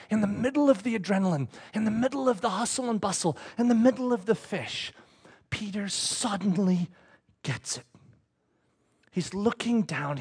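soft, grave narration with pauses; hushed storytelling. Real, not acted. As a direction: draw listeners in with a quiet, slowing telling. Hushed, dramatic telling of the climax.

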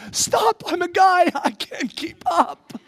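high, loud mock plea; hammed-up cry to stop; guy begging an excited woman to slow down; overwhelmed.

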